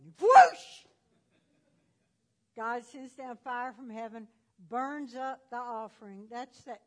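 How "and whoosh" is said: loud whoosh. Acted. Fire falling from heaven.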